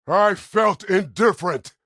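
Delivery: high, loud, stretched-out yell; full-force battle shout; shouted retort. Acted. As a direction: shout each word forcefully. Combat bark, shouted reply.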